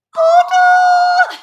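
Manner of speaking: very high, loud mock squeal; excited mimicking shriek. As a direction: shriek it like a crowd of smitten fans. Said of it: "and they're all like".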